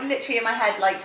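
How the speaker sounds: usual speaking voice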